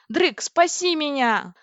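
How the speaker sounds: drawn-out shout for rescue; cry for help. Acted. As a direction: call desperately to be saved. Crying for rescue under fire.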